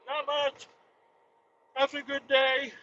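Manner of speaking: high, soft owner voice. Retold or imitated.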